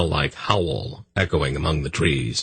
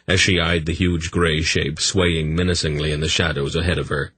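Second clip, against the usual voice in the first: ordinary delivery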